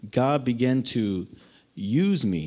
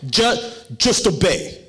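a hint of the pastor, firm and a bit higher; Pastor Sam's motto; 4 semitones higher, 3 dB louder than usual. Youth pastor telling him to obey, lightly voiced. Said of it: "That was Pastor Sam's motto".

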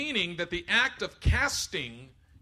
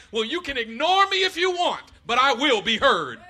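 loud, high, defiant voice of the emotions; the emotions talking; 9 semitones higher, 12 dB louder than usual. Retold or imitated. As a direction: insist on being heard. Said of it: "the emotions are like".

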